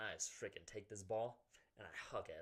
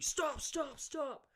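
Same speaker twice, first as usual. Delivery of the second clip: much higher mock coach yell; imitated shouted stop; 5 dB louder, much slower than usual. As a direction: shout it like a coach breaking up a fight. Coach pulling him off.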